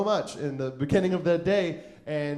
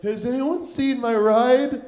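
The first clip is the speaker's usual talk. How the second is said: high, loud, slow lost-person call; hammed-up stranded wail; plaintive calling out; 7 semitones higher, 7 dB louder, slower than usual. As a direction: call out helplessly to a crowd. Calling around for lost ride.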